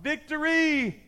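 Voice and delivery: high, drawn-out victory cry. Acted. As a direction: cry it out in triumph, slowly. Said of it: "just cries out".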